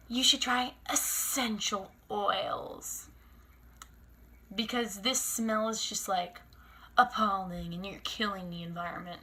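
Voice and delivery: lightly voiced preachy server; imitating a condescending waitress; mock snooty waitress.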